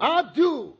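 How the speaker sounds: high, loud Southern slip; emphatic accidental Southern drawl on I DO; Southern accent. Acted. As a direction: blurt it out with a caught Southern accent. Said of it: "stop using that insipid Southern accent".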